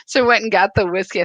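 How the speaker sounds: a quick, laughing-edged retelling; gleeful repeat. Real, not acted. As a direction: rattle off "So I went and got the whiskey." with glee. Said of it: "I thought this was hilarious".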